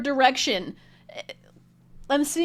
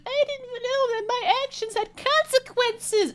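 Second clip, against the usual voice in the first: high, put-on voice of clueless people; mock them with a silly innocent voice; mocking naive voice; 14 semitones higher than usual. Mocking people in power who dodge consequences, in a high silly voice.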